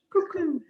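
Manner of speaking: high sung cuckoo call. Shown as a demonstration. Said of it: "And a cuckoo goes".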